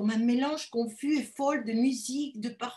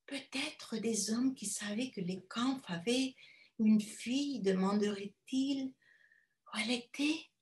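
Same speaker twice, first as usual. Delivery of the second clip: ordinary delivery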